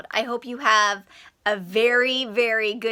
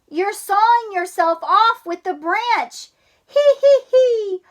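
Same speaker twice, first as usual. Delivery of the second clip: teasing giggle; 9 semitones higher, 5 dB louder than usual. Acted. Sister voiced.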